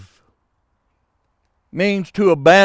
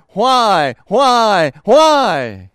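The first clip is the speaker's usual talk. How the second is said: rising anguished cries, louder each time; crying out; 4 semitones higher, 5 dB louder, much slower than usual. Acted. Preacher voicing Jesus crying out on the cross, in anguished loud cries. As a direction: cry it out in anguish, building. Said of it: "he cried".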